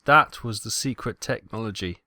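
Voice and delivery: usual speaking voice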